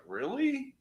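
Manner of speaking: soft, high-pitched query; retold disbelief. Replying to being told it was dead on, soft high disbelief. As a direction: act out doubtful surprise at praise.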